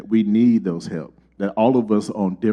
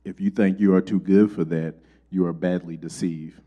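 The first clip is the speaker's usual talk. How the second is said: normal talking voice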